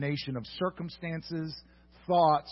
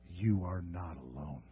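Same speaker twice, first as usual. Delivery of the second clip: tender reassurance; 7 semitones lower, 9 dB quieter than usual. Acted. Preacher comforting the lonely listener, soft and tender. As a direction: lay it gently on them.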